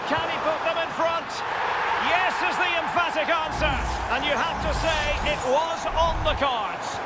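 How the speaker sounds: high, eager call of the winning strike; excited goal call. Acted. Commentator calls the go-ahead goal to viewers, high and eager. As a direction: ride the moment of the go-ahead goal.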